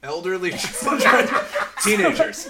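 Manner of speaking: loud, high laughing disbelief. Real, not acted. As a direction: laugh out the question slowly. Laughing at friend's slip.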